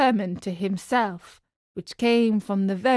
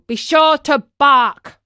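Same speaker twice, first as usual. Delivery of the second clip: loud, slow, raised-pitch order; emphatic farmer's command; stern warning; 6 semitones higher, 6 dB louder than usual. A narrator's reading. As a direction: give the order loudly and slowly. Farmer's last warning to the puppet.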